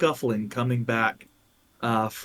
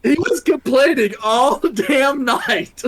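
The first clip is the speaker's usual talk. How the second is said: loud, high-pitched exclamation breaking into laughter; laughing outburst; 12 semitones higher, 6 dB louder than usual. Real, not acted. Game master protests a player's lucky rolls, laughing shout. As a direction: shout it in real disbelief, laughing.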